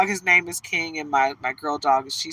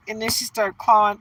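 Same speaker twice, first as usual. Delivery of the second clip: ordinary talk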